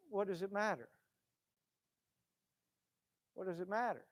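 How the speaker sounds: hushed, gentle question; soft voice of Jesus. Acted. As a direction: ask it softly, like Jesus speaking. Jesus voiced quietly in sermon.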